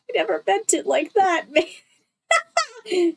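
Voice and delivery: lightly voiced apologetic writer, laughing; mock regretful author. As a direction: pretend to be the sorry author.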